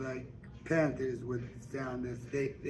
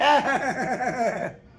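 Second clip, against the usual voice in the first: big, high, loud laugh; hearty laugh; 11 semitones higher, 9 dB louder than usual. Acted. Suge laughs at Mary Agnes's blushing, high and loud. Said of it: "She laughed".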